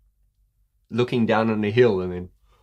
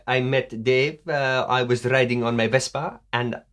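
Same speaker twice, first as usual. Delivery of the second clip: wobbly put-on Italian accent; loosely faked Italian English; mock Italian accent. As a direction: imitate an Italian woman telling her story. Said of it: "is that a French accent?".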